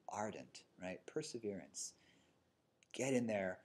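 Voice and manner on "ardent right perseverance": ordinary talk, nothing marked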